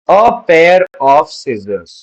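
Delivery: a pair of scissors said very slowly and clearly; slow model phrase. Shown as a demonstration. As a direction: spell it out slowly for students. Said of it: "उसको हम बोलेंगे". (धीमे, साफ़ उच्चारण में)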